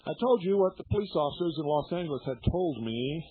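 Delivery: usual speaking voice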